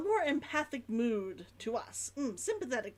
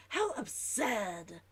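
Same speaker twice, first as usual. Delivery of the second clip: put-on slow, lower Old London verdict; Old London accent; 3 semitones lower, much slower than usual. Shown as a demonstration. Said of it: "Now with the how absurd, I know why I went with that one".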